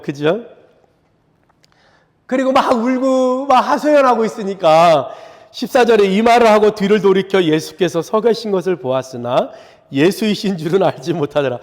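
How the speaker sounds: ordinary delivery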